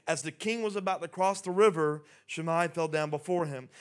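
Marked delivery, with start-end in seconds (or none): none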